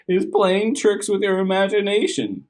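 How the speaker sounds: high laughing mother's voice, amused; a mother chuckling at a silly fear; laughing mommy. Acted. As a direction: laugh it off like a fond mom. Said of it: "Howard's mommy laughed".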